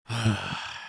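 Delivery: soft, heavy acted sigh; dejected sigh. Friend answering praise, dejected sigh. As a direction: sigh softly before giving in.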